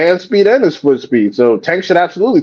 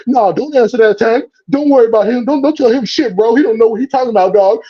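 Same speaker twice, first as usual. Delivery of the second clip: mocking the hangers-on; 7 semitones higher than usual. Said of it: "and you're like".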